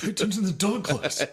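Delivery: high, put-on scolding voice; mock scolding. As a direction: ham up his angry girlfriend-scold. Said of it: "mimicking friend's scolding".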